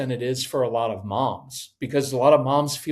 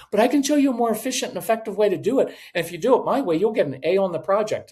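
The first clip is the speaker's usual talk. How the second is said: higher, fast, insistent mock parent; pushy anxious mom; 6 semitones higher, faster than usual. Mom pressing kid about an A, fast anxious nag. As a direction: rattle it off like a nagging mom.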